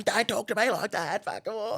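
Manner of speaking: high, heated comeback; indignant retort. Retold or imitated. Woman answering driver's insult, indignant retort. She argues back at him.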